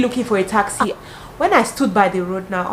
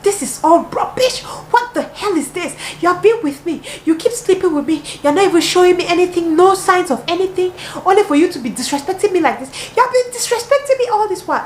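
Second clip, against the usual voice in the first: higher and louder, a hammed-up furious rant; retold angry outburst; 10 semitones higher, 4 dB louder than usual. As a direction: imitate a jealous girlfriend losing her temper, slowed. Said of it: "that girl in front just flipped".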